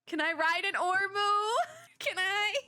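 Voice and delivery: high, giddy squeal with a laugh; excited pleading. Real, not acted. Excited aside to game character.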